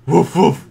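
loud playful woof; mock dog bark. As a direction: bark it like a puppy. Barking as the dog.

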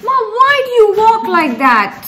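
high, loud, laughing teasing of mum; laughing tease. Real, not acted. Daughter laughing at her mother's walk, high teasing. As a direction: laugh while mocking mum's walk.

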